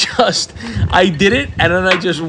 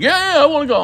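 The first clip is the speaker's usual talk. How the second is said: put-on high, eager kids' yes; eager kids; 11 semitones higher than usual. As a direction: mimic the boys, much higher and keen. Kids eagerly accept ice trip.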